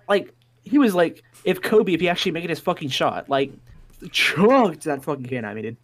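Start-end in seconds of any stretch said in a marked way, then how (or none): none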